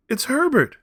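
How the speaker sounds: very high, loud scream. A narrator's reading. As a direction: shriek the name again. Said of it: "she screamed".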